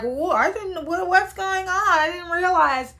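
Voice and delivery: high-pitched imitation of flustered innocence; mock innocent protest. Acts Ashley pleading innocence.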